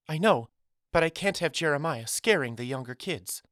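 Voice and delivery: lightly unsettled, pitched well up; quivering voice. A narrator's reading. Miss Carrington worried for the younger kids, quivering. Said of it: "Miss Carrington's voice still carried that quiver as she replied".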